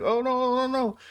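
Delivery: high, rising alarm as the ball rolls off; mock panic. Fan watching the foul ball roll away, high panicked no. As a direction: yelp it like the ball is escaping.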